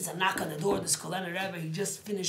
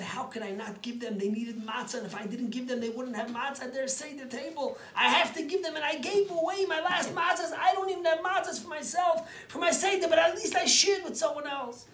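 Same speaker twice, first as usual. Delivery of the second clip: rising higher and louder as he pleads; impassioned rebbe; 10 semitones higher, 8 dB louder than usual. Acted. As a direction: build from a plea to a cry. Rebbe explains giving away matzah.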